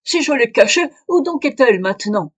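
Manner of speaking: loud, high, heated retort; angry outburst. Acted. Old man's outburst continues.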